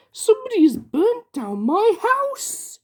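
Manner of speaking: high, slow, stunned cry; shocked Mister Tickle. Acted. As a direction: gasp out the disbelief, high. Mister Tickle finds house burnt.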